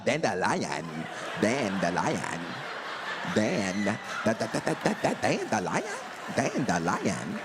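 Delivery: dandelion sounded out slowly, syllable by syllable, stuttering da- da- da-; sounding out dandelion. Shown as a demonstration. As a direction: struggle to spell dandelion aloud. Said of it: "don't even know how to spell".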